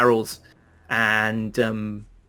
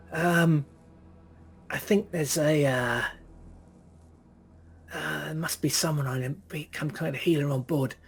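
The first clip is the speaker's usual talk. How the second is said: worried muttering; 3 semitones higher, 5 dB quieter, slower than usual. Acted. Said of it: "he goes".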